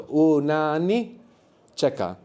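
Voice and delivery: very slow, drawn-out syllables; syllable-by-syllable breakdown. Shown as a demonstration. Teacher assembling the word for students, very slowly. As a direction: build the word up piece by piece.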